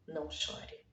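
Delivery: suave e mais baixo, consolando; consolo suave. Pretend. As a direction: sussurre um consolo terno. Deus consola a viúva. (Deus consola a viúva, voz suave)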